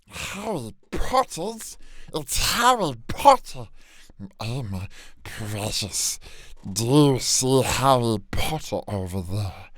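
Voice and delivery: Dobby sliding into a Gollum voice; Smeagol slip. Acted. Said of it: "Starting to sound like Smeagol".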